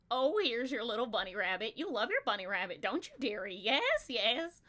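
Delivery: put-on doting old goblin woman, rising to a cackling yes; junk goblin from Labyrinth. Shown as a demonstration. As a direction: coo at the child like a scheming old junk hag. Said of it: "I like doing the junk goblin from Labyrinth".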